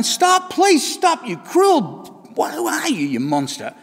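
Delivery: high, slow begging that fades at the end; pleading cup. Acted. Spinning cup pleading with the potter, high desperate begging. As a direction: beg the potter to stop, then sag. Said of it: "and I'm saying".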